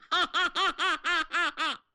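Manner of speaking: big villain's laugh, head thrown back; hearty laugh. Acted. Chiyou laughing at Xuanyuan's troops, booming laugh. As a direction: laugh at the sky in triumph. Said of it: "他仰天大笑".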